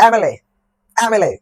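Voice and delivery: এমএলএ with a clear opening "e" vowel; pronunciation demo. Shown as a demonstration. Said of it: "উচ্চারণ করে দেখো এমএলএ".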